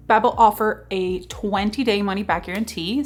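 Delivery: ordinary talk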